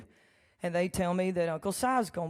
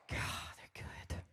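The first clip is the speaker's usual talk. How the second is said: breathy whisper of "they're good"; hushed "they're good" before saying it aloud; whispered aside; 14 dB quieter than usual. Real, not acted. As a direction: say it under your breath. Aside to herself.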